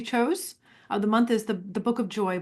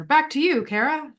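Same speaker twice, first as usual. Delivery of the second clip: higher, louder mock anchor sign-off; imitating a news anchor; 6 semitones higher, 5 dB louder than usual. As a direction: ham up the anchor hand-back. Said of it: "sound like a news anchor".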